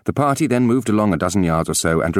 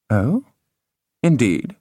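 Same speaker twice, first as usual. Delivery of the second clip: stepmother voice; 5 semitones higher, slower than usual. A narrator's reading. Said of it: "said her stepmother".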